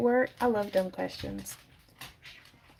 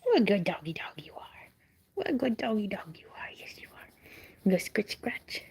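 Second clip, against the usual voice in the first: whisper; 3 dB quieter than usual. Real, not acted. Whispering praise to the dog.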